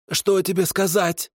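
high hissed retort; hissing kikimora. A narrator's reading. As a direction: spit the question back at him. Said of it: "прошипела она".

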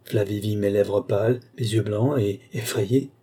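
plain reading voice

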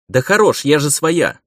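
loud, high-pitched objection; exasperated protest. Acted. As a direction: protest loudly to the guard behind you. Prisoner calls back at silent guard. (возмущённо, громко и высоко)

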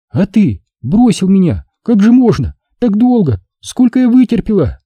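put-on high, girlish voice full of complaint; Lyuba, ardent girl, reproachful. A narrator's reading. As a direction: pour out the hurt in a high voice.

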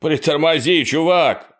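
high, loud yell after a friend. A narrator's reading. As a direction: shout it out desperately. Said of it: "завопил Майк".

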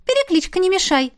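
очень высоко, отмахиваясь; пискляво отмахнуться; отмахивается. Acted. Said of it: "отмахнулся дух". (дух отмахивается от героини, высоким голосом)

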